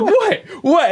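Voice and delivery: high, loud squawked imitation; mock startled. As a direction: imitate a taken-aback wife, very high. Acts out startled wife.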